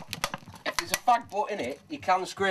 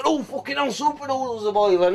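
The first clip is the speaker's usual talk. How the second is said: higher mock panic over the noodles; panicked cook; 7 semitones higher than usual. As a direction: squeal it like a flustered cook. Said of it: "and they're all fucking".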